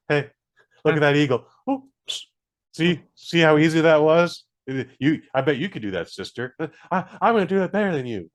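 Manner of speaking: lightly acted, plain-spoken movie characters; a touch of earnest sibling rivalry; basic film dialogue. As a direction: mimic simple English-dub banter. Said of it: "They just talk like this".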